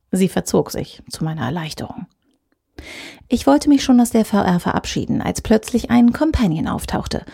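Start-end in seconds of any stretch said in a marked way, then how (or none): none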